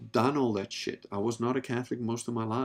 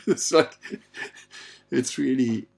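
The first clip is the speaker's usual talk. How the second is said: ordinary delivery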